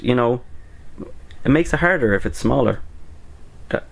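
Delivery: ordinary delivery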